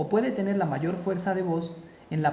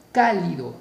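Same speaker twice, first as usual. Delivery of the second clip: cálido with CA punched louder; stress on first syllable; 5 dB louder than usual. Shown as a demonstration. Teacher showing an esdrújula word, cálido stressed on CA. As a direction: hit CA in cálido, let lido fall. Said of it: "mayor fuerza de voz en K".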